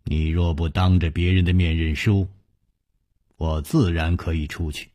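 low, quiet, icy retort; cold sneer. A narrator's reading. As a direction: answer with cold, restrained contempt. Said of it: "冷笑着说".

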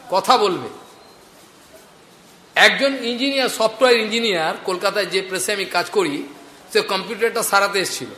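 normal talking voice

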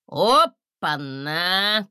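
slow, drawn-out surprised exclamation; an astonished dragon drawing it out. A narrator's reading. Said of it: "В тумане удивились".

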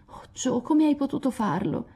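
high, breathy break into tears; hurt, on the edge of sobbing; heartbroken. Acted. Meg to Jo over the forged note, heartbroken. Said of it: "scoppiò in un pianto dirotto".

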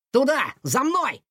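high, urgent rallying call; sudden exclamation. A narrator's reading. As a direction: cry out for others to follow. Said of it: "вдруг воскликнул Котовский". (внезапный высокий возглас-призыв)

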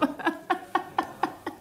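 high, airy gloating laugh; triumphant laugh, barely voiced; breathy mocking laugh. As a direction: let a thin, quiet laugh escape. Gloating after the taunt.

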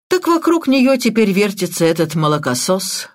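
plain reading voice

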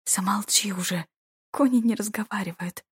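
ordinary delivery, nothing marked

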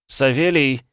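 loud, high-pitched hail; shout. A narrator's reading. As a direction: call out loudly to someone ahead. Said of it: "крикнул я".

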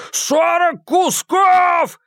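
high, slow, crying yell; wailing shout. Acted. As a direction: wail it out in panic. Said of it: "провопил Малькольм". (истошный вопль)

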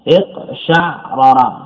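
اقشعرر slowly, iq-sha'-ra-ra with a vowelless ayn; sukun on the ayn. Shown as a demonstration. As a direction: say اقشعرر in parts, stopping on the ayn before ra-ra. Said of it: "بإسكان العين".

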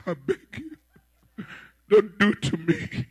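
halting plea, broken by pauses; hesitant, imploring appeal; pleading. Acted. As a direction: beg for mercy, word by word. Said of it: "So he, he begged".

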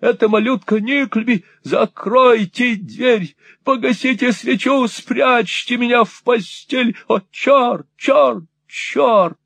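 high, frantic pleading; panic, begging them to hide you; high frightened muffled cries; panicked cries. Acted. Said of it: "приглушённым голосом крикнул он".

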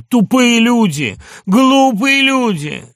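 loud, high, slow cry; repeated outburst. A narrator's reading. Doctor raving alone on the divan, repeated angry outburst. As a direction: drag out each insult in trembling outrage. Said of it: "долго ещё повторял".

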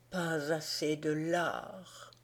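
a fainting king's weak murmur; faint dying voice. A narrator's reading. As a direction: breathe it out weakly, almost lost. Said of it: "d'une voix si faible qu'à peine si on put l'entendre".

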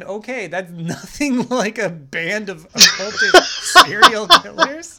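laughing through the words. Real, not acted. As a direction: crack up mid-sentence. Laughing while arguing a point.

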